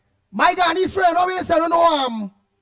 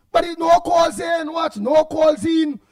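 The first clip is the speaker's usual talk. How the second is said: exasperated shout; 5 semitones higher, slower than usual. Real, not acted. Host protests there are no calls.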